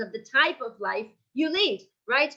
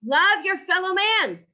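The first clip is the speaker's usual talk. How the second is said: fellow man pressed higher and louder; stress on fellow man; 5 semitones higher, 5 dB louder than usual. Shown as a demonstration. Rabbi stressing the neighbour in the verse. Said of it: "That's the stress".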